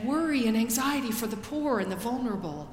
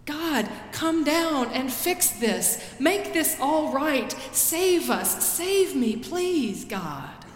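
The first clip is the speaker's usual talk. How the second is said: desperate prayer; 5 semitones higher, slower than usual. Acted. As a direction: beg God to come down, rising in need. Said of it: "where we have said".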